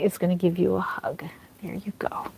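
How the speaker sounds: soft hushed whisper as the doll gives a hug. Real, not acted. Whispering to viewers while the doll hands out hugs. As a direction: breathe it gently, barely voiced.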